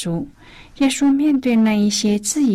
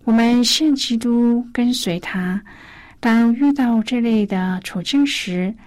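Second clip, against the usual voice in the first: normal talking voice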